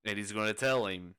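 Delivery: ordinary talk, nothing marked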